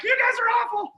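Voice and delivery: high, loud, indignant complaint; indignant girls. Retold or imitated. Retelling the girls' reply to the prank, high indignant voice. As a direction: imitate girls scolding pranksters.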